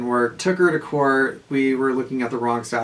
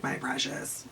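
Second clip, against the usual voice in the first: softer, hissing Gollum impression; 12 dB quieter, slower than usual. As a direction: whisper it like Gollum. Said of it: "literally looks like Gollum".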